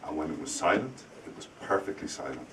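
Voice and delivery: dropping to a hush for perfectly silent; hushed storyteller build. Shown as a demonstration. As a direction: fade into a whisper on silent. Teacher building suspense.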